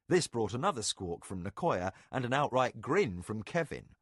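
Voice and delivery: ordinary delivery, nothing marked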